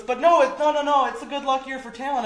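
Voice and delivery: high, loud excited retold woman; imitated woman, excited. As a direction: squeal it like she's eager to fix it. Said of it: "She goes".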